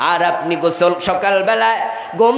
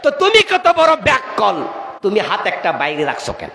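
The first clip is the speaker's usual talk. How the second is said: high, exclaimed rebuke; mock scolding; 3 semitones higher than usual. Preacher to the man with his hand outside, mock scolding. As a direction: cry out at his foolishness.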